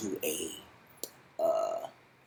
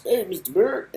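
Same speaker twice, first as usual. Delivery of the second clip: much higher, much louder sung-out "Hey, Mister Byrde"; [name] style call; 12 semitones higher, 13 dB louder than usual. Retold or imitated. Narrator calling out to Marty Byrde, loud high [name]-style shout. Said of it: "as they say in, uh, [name] movies".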